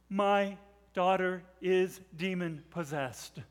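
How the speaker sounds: slow, word by word; measured admission with gaps between words; grave confession. Acted. Confession of shame, acted slowly.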